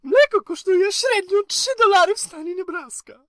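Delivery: put-on squeaky little boy voice, way up high; high child voice. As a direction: play a small kid, high pitched. Grandson kid voice in Polish.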